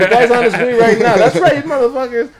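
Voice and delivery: mock threatening call. Acts out someone threatening the group.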